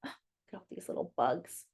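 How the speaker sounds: soft, quick, hushed aside; hushed wonder. Acted. Teacher showing bugs to children, hushed and amazed. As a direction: draw the kids in with a hush. Pointing at picture for kids.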